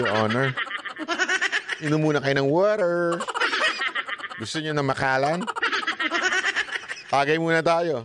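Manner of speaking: low, sweet teasing courtesy; mock soothing a hothead; mock politeness. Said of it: "Hindi ikaw pwede magsabi". (panunuyang magalang)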